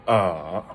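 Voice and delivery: "uh" dragged through a full dip and rise; exaggerated third tone. Shown as a demonstration. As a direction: overdo the down-up curve on "uh". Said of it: "like, the third tone".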